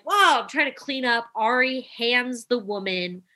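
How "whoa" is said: alarmed whoa. Retold or imitated. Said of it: "I'm like".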